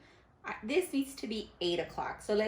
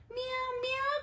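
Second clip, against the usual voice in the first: cat meow. Acted. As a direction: meow like a storybook kitten. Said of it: "cried the cat".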